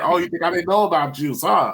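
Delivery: high, fast, teasing imitation; mock Chappelle. Fan quoting Chappelle to a friend, high teasing voice. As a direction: tease it quick and high. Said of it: "He's like".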